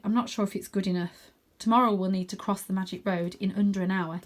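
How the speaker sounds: ordinary delivery, nothing marked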